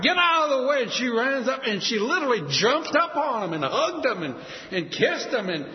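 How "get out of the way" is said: high, loud cry to clear the way; pushing through crowd. Acted. Wife running through airport crowd, loud high cry. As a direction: shove through and shout. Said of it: "she ran through the crowd".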